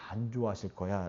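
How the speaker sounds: low, hushed acted accusation; quiet voice in the ear, not fully whispered; insinuating whisperer. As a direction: drop low and soft to plant the doubt. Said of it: "옆에서 귀에 속삭이는 거예요".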